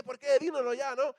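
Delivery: loud, high impatient call; mock crowd begging for the answer; eager crowd. Crowd urging the evangelist to tell them, loud and high. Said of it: "la gente estaba así".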